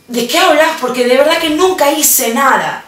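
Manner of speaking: negación suplicante; pleading denial. Retold or imitated. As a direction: insist you did nothing, Mom. Daughter protesting innocence to mom.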